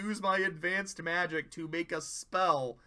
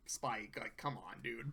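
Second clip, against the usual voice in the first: mumbled rebuke; 8 semitones lower, 12 dB quieter than usual. Retold or imitated. Twilight scolds Spike mildly.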